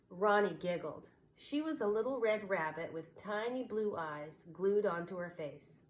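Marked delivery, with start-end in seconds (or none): none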